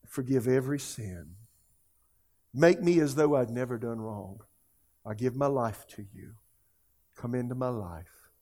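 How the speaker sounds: measured prayer in short phrases, pausing for repetition; leading a prayer. Real, not acted. Preacher leading viewers in a prayer of surrender, prayerful and hushed.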